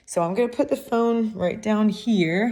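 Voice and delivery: ordinary talk, nothing marked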